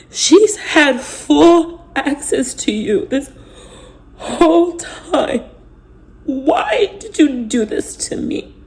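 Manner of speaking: high, slow, crying plea; tearful plea. Retold or imitated. To her husband, slow tearful pleading. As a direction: ask why while crying. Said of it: "And I said".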